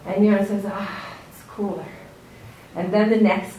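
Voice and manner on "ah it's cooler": soft, breathy sigh of relief; whispered relief. Retold or imitated. Yonah sighs with relief in the cool shade.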